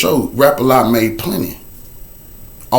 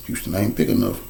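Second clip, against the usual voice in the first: much softer, lower ominous refrain; hushed refrain; 6 semitones lower, 12 dB quieter than usual. Acted. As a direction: sink the line to a low hush. Repeated refrain.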